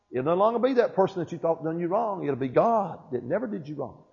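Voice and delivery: usual speaking voice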